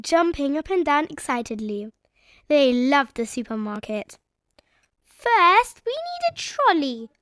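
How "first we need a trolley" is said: put-on high little-girl voice; bossy small child planning; Peppa's voice. A narrator's reading. Peppa telling her family what they need, high and eager. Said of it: "said Peppa".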